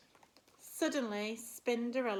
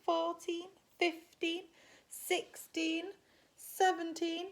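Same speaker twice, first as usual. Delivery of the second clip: counting up excitedly; 6 semitones higher, 4 dB louder than usual. Acted. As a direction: count up, growing louder and more thrilled with each number. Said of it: "shout out a number".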